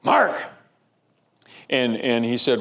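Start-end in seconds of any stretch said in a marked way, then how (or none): 0.0-0.4 high, loud gruff call; imitating a gruff old preacher calling out; gruff shout. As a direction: shout the name across a crowded entryway. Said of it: "He was always gruff like that".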